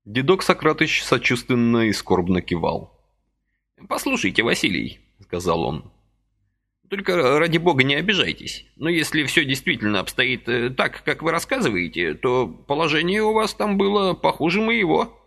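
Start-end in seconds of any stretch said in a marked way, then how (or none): 3.9-15.2 put-on high fussy old man's voice, gently reproaching; a little old man chiding kindly; old man reproaching Vasily for his haste, high and fussy; reproachful old man. A narrator's reading.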